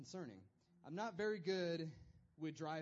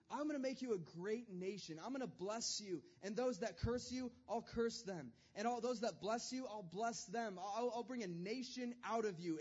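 raised, earnest voice of God; God promising; 4 semitones higher than usual. Retold or imitated. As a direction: promise it eagerly, like a friend. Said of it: "He said".

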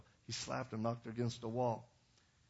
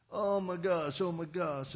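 raised-pitch mock wimp; whiny wimp; 5 semitones higher, 4 dB louder, slower than usual. A wimp fretting in the pastor's office, whiny. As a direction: imitate a wimp fretting, higher and louder.

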